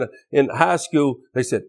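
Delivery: normal talking voice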